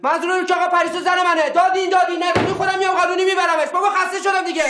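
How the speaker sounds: high, loud frustrated shouting; angry outburst. Real, not acted. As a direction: snap at the uncle, fed up. Nephew loses his temper at uncle.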